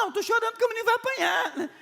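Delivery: put-on high, fast child's voice with a laugh in it; crying little boy. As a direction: act the boy whining through tears. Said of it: "Ele falou". (filho explicando por que chora, voz aguda e rápida)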